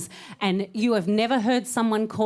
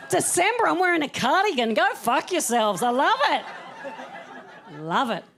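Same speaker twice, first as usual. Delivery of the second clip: ordinary talk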